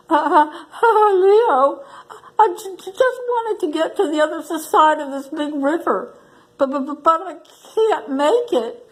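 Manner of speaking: a tiny frightened mouse stuttering to the lion; scared stutter. Acted. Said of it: "to shake and stutter".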